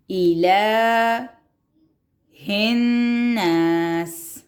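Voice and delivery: very slow chanted الناس with doubled n; slow tajweed chant; slow recitation. Shown as a demonstration. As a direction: stretch every syllable. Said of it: "شد".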